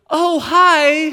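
high, loud chirp of sudden discovery; toddler's surprised greeting. Retold or imitated. Son greets dad pushing his stroller, surprised high child voice. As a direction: imitate a little kid suddenly noticing dad. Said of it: "Just like that".

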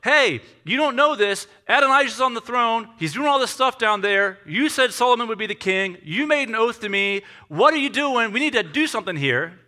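high, loud, urgent pleading; hammed-up alarm, demanding action; acted panic. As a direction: press the king frantically, each line punched. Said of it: "maybe panicked".

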